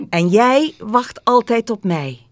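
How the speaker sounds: high, loud, emphatic joy; delighted girl's declaration; happy. A narrator's reading. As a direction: declare it gladly and with conviction. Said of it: "zei het meisje blij".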